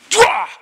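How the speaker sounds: a sudden, very loud, high cowboy shout; gunfight yell. Retold or imitated. Sleepwalking brother yelling at dad as if at a gunfight. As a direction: blast it out like a shootout at the O K Corral. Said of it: "He went".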